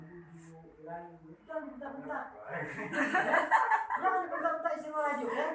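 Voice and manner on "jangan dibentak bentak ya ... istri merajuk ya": chuckling through a playful warning; amused warning with laughter to the congregation; laughing while preaching. Real, not acted. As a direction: laugh as you scold lightly. Teasing audience about sulking wives.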